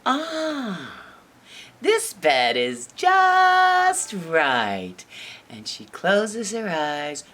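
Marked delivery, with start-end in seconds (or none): 0.1-0.9 high, falling cosy "ah"; blissful settling-in sigh; contented sigh. Acted. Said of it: "she snuggles up and she's like". 1.8-5.0 slow, savoured delight; stretched-out, snug contentment; contented Goldilocks. Acted. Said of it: "she snuggles up and she's like".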